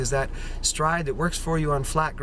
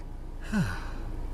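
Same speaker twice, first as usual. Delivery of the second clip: spent sigh; 5 semitones lower, 7 dB quieter than usual. Retold or imitated. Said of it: "And then you're like".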